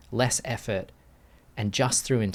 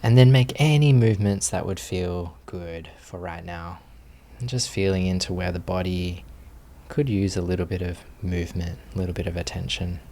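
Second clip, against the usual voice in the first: guided meditation. Acted. Teacher settling students into posture, calm guided meditation voice. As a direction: ease the group gently into stillness.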